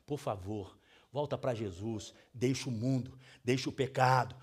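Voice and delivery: soft, tender plea, almost hushed; a soft, loving appeal to a straying believer; gentle pleading. Retold or imitated. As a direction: coax them back to Jesus softly. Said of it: "Aí você tem que chegar e falar".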